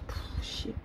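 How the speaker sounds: unvoiced exasperated puff; scornful sigh. Real, not acted. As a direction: blow out air in disgust. Export failed, annoyed.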